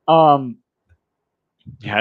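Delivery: ordinary talk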